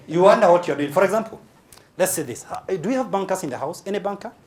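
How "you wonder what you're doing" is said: usual speaking voice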